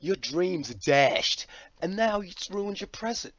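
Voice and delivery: put-on dramatic American accent, loud. Vlogger dramatising crushed dreams, in a put-on American accent. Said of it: "I keep jumping into this American accent".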